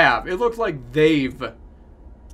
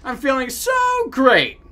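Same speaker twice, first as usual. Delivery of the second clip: happy buddy; 6 semitones higher, 4 dB louder than usual. Acted. Voicing the game's happy buddy.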